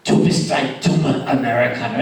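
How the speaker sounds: normal talking voice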